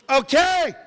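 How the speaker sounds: high-pitched acted concession; giving in. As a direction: give in with a high, loud okay. Meekly gives in to wife.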